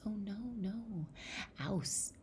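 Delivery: high, soft owl voice; storybook owl. Retold or imitated. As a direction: softly hoot it like a storybook owl.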